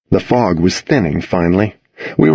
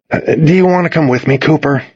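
higher, quick exasperated question; 6 semitones higher than usual. A narrator's reading. Boy to his insistent dog at the door, exasperated. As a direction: give in to the pestering dog, exasperated. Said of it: "I asked in exasperation".

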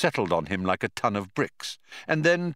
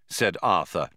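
ordinary delivery, nothing marked

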